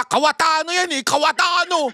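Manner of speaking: high, sing-song name-calling; mocking taunt. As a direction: taunt like the old critics did. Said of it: "ano tawag niyo sa amin nun?".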